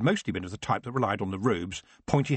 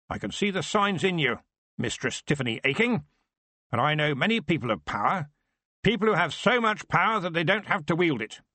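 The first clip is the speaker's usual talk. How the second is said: big wizard voice; 6 semitones higher than usual. Acted. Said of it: "in a big voice too".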